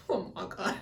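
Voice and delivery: stunned, breathy oh my God; shocked gasp. Real, not acted. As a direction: barely get the words out in disbelief. Shocked at the scene.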